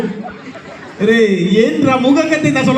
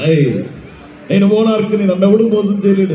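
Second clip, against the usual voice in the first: low-pitched film-star mimicry; deep, measured boast in a movie villain's manner; Prakash Raj; 6 semitones lower than usual. Mimic demonstrating a voice to a rival, low film-dialogue impression. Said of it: "అది ప్రకాష్ రాజా?".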